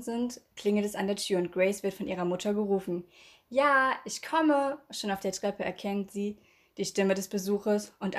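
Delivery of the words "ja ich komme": a slow, higher call upstairs. A narrator's reading. Grace calling down to her mother, drawn-out call. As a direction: stretch it out so it carries down the stairs.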